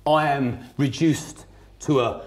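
ordinary delivery

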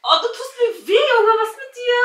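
loud and high, hurt; pained cry. Real, not acted. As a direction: protest loudly, in pain. Friend hurting her in fight.

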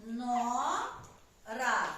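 НОРА split with a long pause between syllables; Нора drawn out, each syllable held apart; syllable-by-syllable dictation. Shown as a demonstration. Dictates syllables while class writes.

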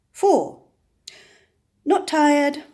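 sudden wide-awake jolt; surprised. A narrator's reading. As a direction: snap out of the count, surprised. Counting ends, then a jolt.

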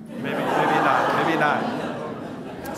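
slow laughing reply; slow and amused, with a laugh. Real, not acted. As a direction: laugh through a teasing maybe. Student answers with a laugh.

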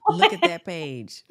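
talking through a laugh. Real, not acted. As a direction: laugh while saying it, amazed. Laughing at the long course list.

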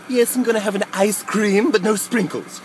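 normal talking voice

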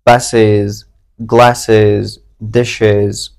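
buses, glasses, dishes each said with a clear extra "ez" syllable at the end; added syllable. Shown as a demonstration. Teacher showing students the extra plural syllable. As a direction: stretch the plural ending into its own syllable.

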